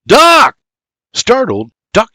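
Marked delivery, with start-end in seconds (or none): 0.0-0.6 sharp high, loud call; shout. A narrator's reading. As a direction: shout his name to wake him. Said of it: "he shouts".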